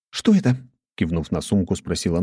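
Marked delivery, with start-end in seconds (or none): 0.1-0.5 put-on young woman voice. A narrator's reading. Nastya voiced.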